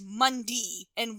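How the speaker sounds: Midwestern MONDAY, the ending said "dee"; Midwestern accent. Retold or imitated. Imitating Fred Ward saying the days of the week, Midwestern "Mundee" for Monday. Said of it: "very Midwestern accent".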